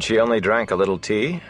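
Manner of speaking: usual speaking voice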